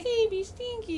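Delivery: high-pitched gushing over a pet. Real, not acted. Owner fussing over her sleepy stinky pet, high cooing baby talk.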